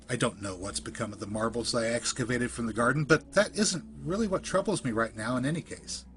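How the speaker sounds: ordinary delivery, nothing marked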